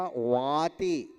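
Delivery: वाति said slowly with a clear v at the start; teacher's slow model of वाति; careful pronunciation. Shown as a demonstration. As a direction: draw out वाति syllable by syllable. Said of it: "क्या उच्चारण कर रहे हैं".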